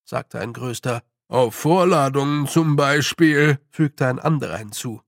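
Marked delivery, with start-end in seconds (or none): 1.3-3.6 louder character voice chiming in; loud, fretful clansman; alarmed little man. A narrator's reading. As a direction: throw in your own dreaded example. Second clansman chimes in alarmed.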